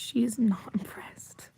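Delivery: slow whispered comment; whispered aside. Real, not acted. Commenting on her unimpressed pet, slow whisper. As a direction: whisper it slowly, amused.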